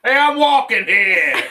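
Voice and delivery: put-on loud, slow New York accent. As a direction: bellow it like a New York cabbie. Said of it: "That's New York".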